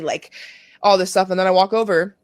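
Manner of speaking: usual speaking voice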